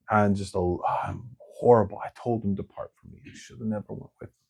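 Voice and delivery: soft, broken self-blame fading to a murmur; gutted Peter. Retold or imitated. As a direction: sink into shame, barely voiced. Peter gutted after crucifixion.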